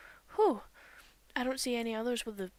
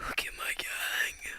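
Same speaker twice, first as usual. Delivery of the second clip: whisper; slower than usual. Real, not acted. Gamer looks over her team, whispered. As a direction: breathe it out in awe.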